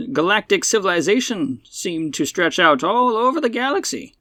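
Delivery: put-on high-pitched old-time radio old man; old-man radio personality. Old hot dog man explains the Ruskies to Buck, old-man radio voice. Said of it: "nineteen twenties radio personality with the old man accent".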